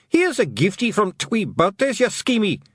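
high Scots fighting yell. Acted. Little man about to butt the cat, high yelling. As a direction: hurl the insult high, in a put-on Scots voice. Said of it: "he yelled".

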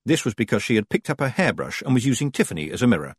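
plain reading voice

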